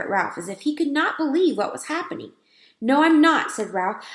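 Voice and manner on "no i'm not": louder, higher mouse insisting; a touch of indignant mouse; insistent mouse. A narrator's reading. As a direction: mouse protesting firmly. Ralph insists.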